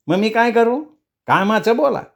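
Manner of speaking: high, curt nasal elder; brusque old man, pitched well up. A narrator's reading. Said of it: "नाकात बोलणारा".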